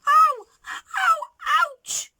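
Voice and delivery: sharp high yelps of pain, loud; crying out in pain. A narrator's reading. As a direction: cry out as a tooth cracks. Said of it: "he cried".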